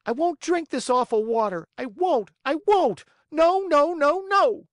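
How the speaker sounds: high, loud wailing refusal building up; crying tantrum. A narrator's reading. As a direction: wail it out like a sobbing child. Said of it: "bursting out crying".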